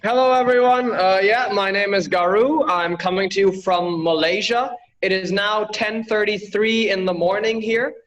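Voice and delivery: ordinary talk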